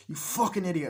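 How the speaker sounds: high, exasperated insult; acted berating. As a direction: scold a foolish partner. Berating in retold quarrel.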